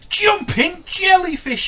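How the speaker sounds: high, loud, slow little fish exclamation; groaned exclamation. Acted. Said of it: "he groaned".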